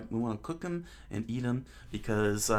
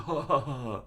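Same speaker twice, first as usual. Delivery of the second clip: acted laugh out loud; 2 semitones higher, faster than usual. Owner laughs at old man's offer, hearty ha-ha. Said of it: "laughs out loud".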